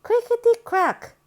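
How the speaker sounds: high, crackly crickety crack; cracking egg sound. Acted. Storyteller voicing the little egg cracking open. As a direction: voice the egg breaking.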